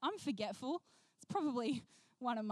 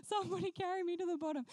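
high, quick comic whine for rescue; mock helpless plea; 6 semitones higher, faster than usual. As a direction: plead pitifully, hammed up. Voices self stranded, pleading for rescue.

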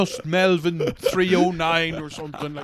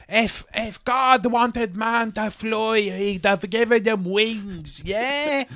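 slow mock preacher; 5 semitones higher, slower than usual. Mocking old-fashioned Irish man.

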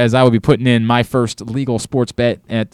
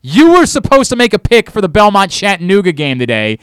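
mock angry rant; 7 semitones higher, 5 dB louder than usual. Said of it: "I'm sorry for being so angry".

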